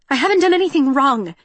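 fast, loud, high plea; pleading. A narrator's reading. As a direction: plead in panic. Pleading with armed android.

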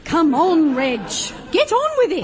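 impatient urging. Acted. Bored player hurrying Reg through the rules, impatient.

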